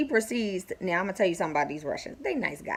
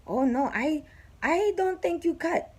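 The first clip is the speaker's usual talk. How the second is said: lightly voiced worker, higher and slow; a hint of a doubtful foreign handyman; Russian worker; 10 semitones higher, slower than usual. Neighbor's worker doubting her, lightly voiced higher.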